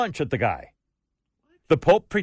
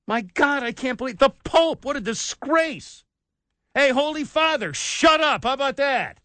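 raised, higher indignant yelling at the Pope; higher pitched outraged yelling; angry outburst; 7 semitones higher than usual. Real, not acted. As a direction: tell the Holy Father off, outraged.

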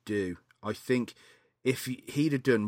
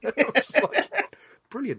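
laughing; 11 semitones higher, 3 dB louder than usual. Real, not acted. Laughing at Bad Santa ending.

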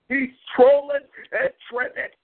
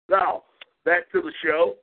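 usual speaking voice

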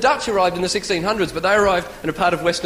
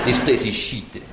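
low-pitched mock French accent; disdainful French-accented verdict; 8 semitones lower, slower than usual. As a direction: put on a deep French accent. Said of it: "The French looked around and went".

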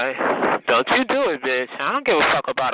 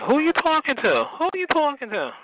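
much higher, sassy "who you talking to"; indignant comeback; 10 semitones higher than usual. Retold or imitated. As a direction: fire it back like an offended challenge. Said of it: "I'm like".

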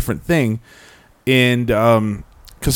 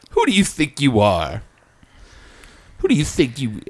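mock diva rant; 3 semitones higher than usual. Mock entitled celebrity rant.

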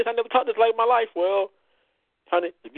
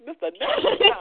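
laughing echo; 14 semitones higher than usual. Real, not acted. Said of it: "echoing the nickname".

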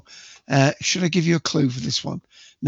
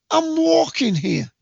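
high, slow street-tough shout; New York tough guy; 13 semitones higher, slower than usual. Shown as a demonstration. Quiz host repeating the movie line for players. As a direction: drag it out like an angry New Yorker. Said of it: "Got to have a bit of, you know, character".

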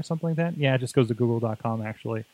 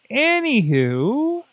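sing-song; 10 semitones higher, 3 dB louder, much slower than usual. Acted. Playful sing-song filler.

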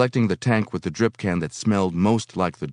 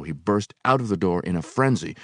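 ordinary delivery

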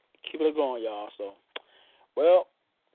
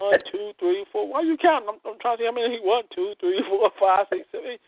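counting climaxes in amazement; excited counting; 7 semitones higher than usual. Pretend. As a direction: count each one off high and thrilled. Mock counting spasms.

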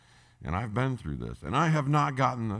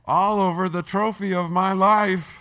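loud; 8 semitones higher, 11 dB louder than usual. Acted. Dramatic peak of the car story.

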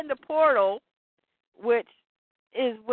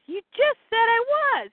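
high-pitched acted objection; indignant protest; 11 semitones higher than usual. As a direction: throw the accusation back, pitched right up. Scott argues back at Barda.

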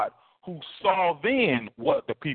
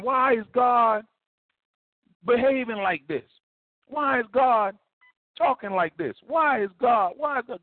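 confused questioning; 9 semitones higher than usual. Retold or imitated. Confused believers voiced questioning God.

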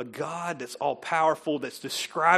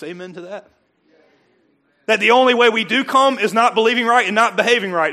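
loud, higher voice, pushing each line; full-force preaching; 7 semitones higher, 12 dB louder than usual. Acted. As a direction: preach it hard to the crowd.

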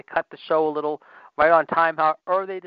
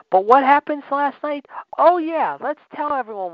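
sarcastic rant; 10 semitones higher, 3 dB louder than usual. Real, not acted. Sarcastic ranting question.

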